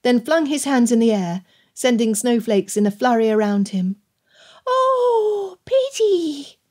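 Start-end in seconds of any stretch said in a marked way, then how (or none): 4.7-6.6 put-on very high, drawn-out child's squeal; delighted toddler. Toddler flinging snowflakes in the air, delighted. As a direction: squeal it like a tiny child at play.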